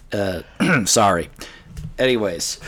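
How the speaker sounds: ordinary delivery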